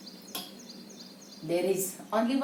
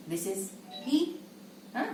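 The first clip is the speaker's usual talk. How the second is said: usual speaking voice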